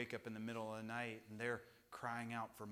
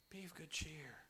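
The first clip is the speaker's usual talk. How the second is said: soft unvoiced whisper; 4 semitones higher, 8 dB quieter than usual. Preacher repeating God's words to the congregation, soft whisper. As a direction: breathe it gently like a comfort. Same verse, now whispered.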